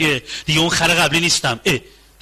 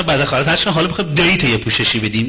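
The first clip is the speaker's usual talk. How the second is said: ordinary talk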